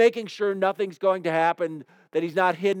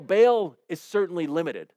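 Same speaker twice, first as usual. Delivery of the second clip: ordinary talk, nothing marked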